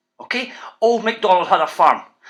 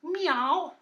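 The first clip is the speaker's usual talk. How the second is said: cat meow; 6 semitones higher, 9 dB quieter than usual. Shown as a demonstration. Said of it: "Sounds the same as a noise".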